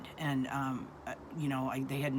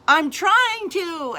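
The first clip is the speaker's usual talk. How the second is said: high, drawn-out desperate yell; reenacted frantic scream from the driver; retold scream; 12 dB louder, much slower than usual. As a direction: wail it loud and slow in protest. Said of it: "and he's screaming".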